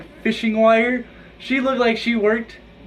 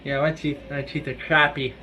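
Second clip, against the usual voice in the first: normal talking voice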